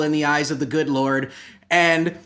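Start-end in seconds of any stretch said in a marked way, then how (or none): none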